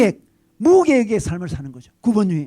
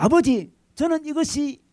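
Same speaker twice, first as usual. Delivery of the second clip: earnest son; 8 semitones higher, 5 dB louder than usual. Retold or imitated. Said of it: "그때 아들이 이렇게 이야기합니다".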